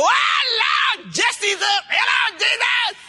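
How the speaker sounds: high, sustained holler to heaven; piercing, gleeful church yell; wake-up praise shout. Acted. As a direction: wake the whole camp with praise. Waking camp with shouted praise.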